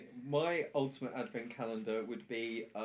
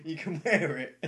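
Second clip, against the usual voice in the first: laughing; 4 semitones higher, 8 dB louder than usual. Real, not acted. Joking about advent gifts.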